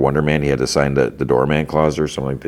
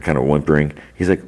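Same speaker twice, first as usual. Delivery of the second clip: normal talking voice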